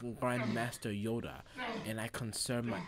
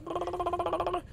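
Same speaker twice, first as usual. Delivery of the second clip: short high whimper; high mock whine. Father giving up with a high mock whimper. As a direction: squeak out a beaten whimper. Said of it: "And he's like".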